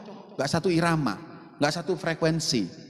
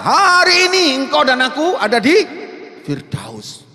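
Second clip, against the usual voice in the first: proclaimed promise; 8 semitones higher, 8 dB louder than usual. Acted. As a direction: announce it like a solemn promise. Jesus quoted, raised voice. (proklamasi lantang lalu turun pelan)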